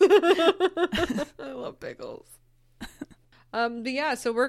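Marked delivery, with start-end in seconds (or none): 0.0-2.3 slow laughing declaration; laughing, drawn out. Real, not acted. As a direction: stretch it out through a laugh. Laughing, drawn-out echo of friend.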